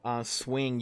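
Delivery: ordinary delivery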